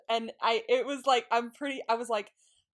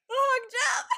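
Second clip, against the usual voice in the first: much higher, laughing delight; giddy laughing echo; 14 semitones higher, 4 dB louder than usual. Real, not acted. Echoing her co-host about the hug jump, giddy laughter. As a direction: echo it with a squeal.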